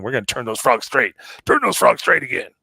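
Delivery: building to a high, loud shouted slogan; mock rallying cry. Host rallying listeners for a joke cause, mock rallying cry.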